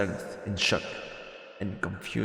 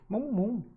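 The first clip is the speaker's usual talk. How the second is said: squeaky high creature "mo mo mo"; high-pitched little critter sounds; cute creature chirp; 12 semitones higher, 3 dB quieter than usual. Pretend. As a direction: chirp it like a tiny pet creature. Creature noise in game.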